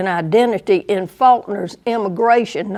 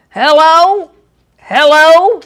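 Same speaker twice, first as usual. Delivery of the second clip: high, loud Southern drawl calling hello; broad Southern hello, higher and louder; Southern accent; 7 semitones higher, 8 dB louder, slower than usual. Shown as a demonstration. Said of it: "Here's a just perfect Southern".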